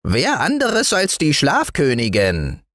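high little gnome, mildly grumbling; lightly grumbled, high-pitched retort; grumbling dwarf. A narrator's reading. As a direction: answer as a grumpy tiny man. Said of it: "brummte das Waldmännchen".

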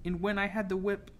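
a touch of nervous stammer. A narrator's reading. Girl gathering strength to explain to captain, stammering. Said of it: "I stammered".

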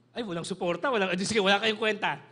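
loud, mock-exasperated blurt; mock outburst. Mocking dismissive remark to mothers.

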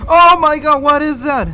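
very high, loud panicked cry; terrified scream. Real, not acted. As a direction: shriek it in sudden horror. Sees something frightening in the game.

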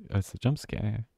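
ordinary talk

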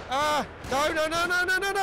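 rising panicked no no no; panicking gamer. Real, not acted. Dynamite thrown, rising panic.

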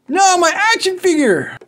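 high, loud dismayed wail; mock dismay. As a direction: cry out over the fallen toy. Toy falls over.

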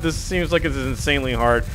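normal talking voice

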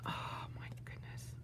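breathless whispered wonder; awed whisper. Real, not acted. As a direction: whisper it in awe.

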